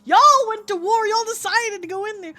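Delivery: indignant yelling. Real, not acted. Blaming chat for the war.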